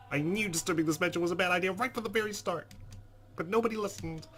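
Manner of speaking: loud, high-pitched indignant rant; frantic, exasperated tirade; panicked outburst. Acted. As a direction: rant at the top of the voice, fed up and scared. Character's panicked outburst, leaving haunted house.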